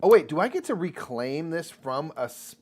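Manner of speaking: ordinary talk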